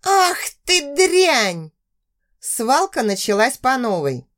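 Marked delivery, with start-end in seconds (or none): 0.0-1.7 high, slow furious shriek; furious scream. Acted. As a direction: shriek it in rage. Girl attacks rival again.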